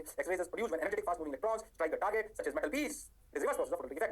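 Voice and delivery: slow, measured definition read-out; dictation. Real, not acted. Teacher dictating the X-ray definition, slow dictation. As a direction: dictate it slowly, word by word.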